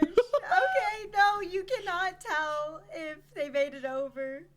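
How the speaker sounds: laughing through the ruling. Real, not acted. GM laughing as she denies the player. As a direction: giggle while saying no.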